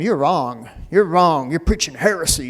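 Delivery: self-mocking know-it-all telling preachers off; pompous mock accusation; mock scolding. Mimics his know-it-all younger self correcting preachers.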